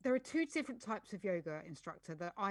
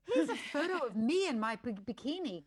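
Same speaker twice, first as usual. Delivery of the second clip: mocking influencer; 5 dB louder than usual. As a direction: parody a show-off bikini post. Mimics a bikini handstand post.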